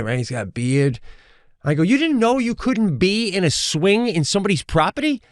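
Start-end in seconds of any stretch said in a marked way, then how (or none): 1.8-5.2 high, incredulous comeback; mock-outraged, pitch raised throughout; retold anger. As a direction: throw it back as an indignant question. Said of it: "I was definitely more mad".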